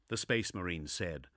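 plain reading voice

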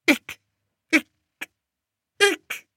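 very high whimpered hiccups; whimpering hiccups. A narrator's reading. Frightened king hiccuping to his lords, whimpering. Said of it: "whimpered the king".

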